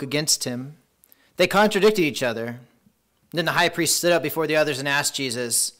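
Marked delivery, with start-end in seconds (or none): none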